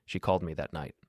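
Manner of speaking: plain reading voice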